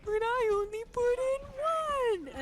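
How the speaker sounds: high, loud, drawn-out protest; hammed-up cartoon wail; cartoon prankster's cry. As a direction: belt out the punchline slowly, high-pitched. Cartoon prankster's punchline, re-enacted.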